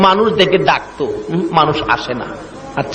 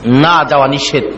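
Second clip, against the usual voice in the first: ordinary talk, nothing marked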